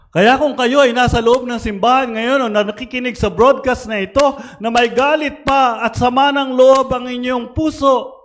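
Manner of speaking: high, fast, driving homily; full-force preaching. Real, not acted. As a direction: preach it out high and urgent to the whole church.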